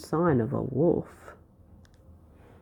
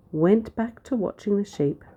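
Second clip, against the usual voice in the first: ordinary delivery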